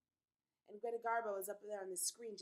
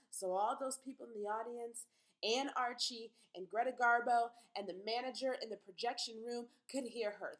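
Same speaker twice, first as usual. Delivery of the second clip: ordinary talk, nothing marked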